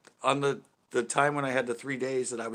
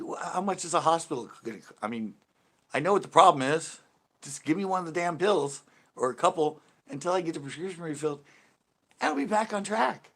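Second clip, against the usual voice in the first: higher, louder, pleading and exasperated; 7 semitones higher, 5 dB louder than usual. Retold or imitated. Retelling pleading with the pharmacist, high and loud. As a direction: beg the pharmacist for the pills in rising frustration.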